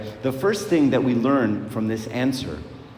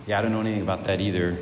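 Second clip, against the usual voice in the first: offhand shrug; 3 semitones lower, much faster than usual. Retold or imitated. Thief answers angels, retold.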